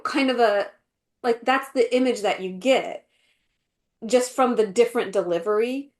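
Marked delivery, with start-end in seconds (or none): none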